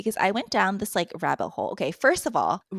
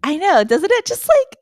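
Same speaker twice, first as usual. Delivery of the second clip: much higher, louder squeal; excited shriek; 13 semitones higher, 8 dB louder than usual. Real, not acted. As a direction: squeal with outrage. Shared shock at fake show.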